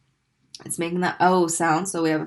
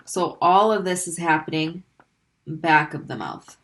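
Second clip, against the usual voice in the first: normal talking voice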